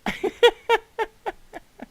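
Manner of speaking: loud helpless laughter; long high laugh. Real, not acted. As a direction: howl with laughter. Laughs hard at bridge.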